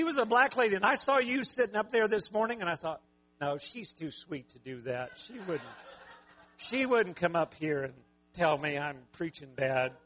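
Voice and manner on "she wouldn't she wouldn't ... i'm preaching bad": normal talking voice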